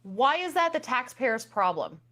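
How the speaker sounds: ordinary delivery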